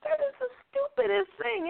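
very high-pitched mock disbelief; incredulous. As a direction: imitate someone laughing in stunned disbelief. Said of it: "incredulously, just like everybody else, going".

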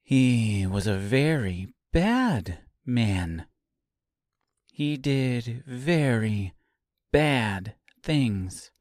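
slow, measured, menacing precision; deliberate, carefully enunciated, with pauses; perfect diction. A narrator's reading. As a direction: spell it out phrase by phrase. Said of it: "spoke with perfect diction".

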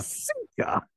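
sinker squeaked very high; breathy high squeak on the last word. Shown as a demonstration. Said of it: "I used to be able to do it".

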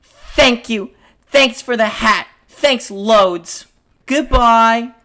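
high, loud stage thanks; Marie's curt exit. Acted. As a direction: fling thanks on the way out. Marie leaves the scene.

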